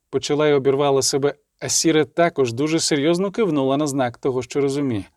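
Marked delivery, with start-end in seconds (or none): none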